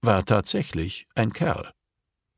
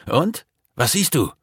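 high, eager call; 8 semitones higher than usual. A narrator's reading. Max asks his friend what he sees, calling out. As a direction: call the question up, high and urgent. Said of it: "rief Max".

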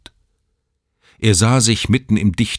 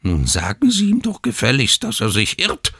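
grumpy; 6 semitones higher than usual. A narrator's reading.

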